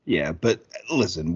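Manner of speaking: ordinary talk, nothing marked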